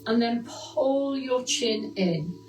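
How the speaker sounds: steady, clear fitness class cues; exercise instruction. Acted. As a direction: guide the class through each move. Leads posture exercises over music.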